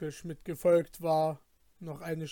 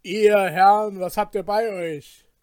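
loud, hammed-up courteous robber; mock polite robber; 4 semitones higher, 12 dB louder than usual. Companion imitates robbers politely demanding valuables, mock courteous. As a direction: play the robber's false politeness. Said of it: "fragten ganz höflich".

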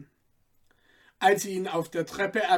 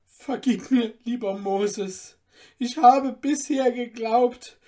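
higher and louder, moved to tears; tearful thanks; 5 semitones higher, 5 dB louder than usual. Acted. Student embracing Moses, moved thanks. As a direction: thank him with emotion breaking through. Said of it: "Große Tränen kamen ihm in die Augen".

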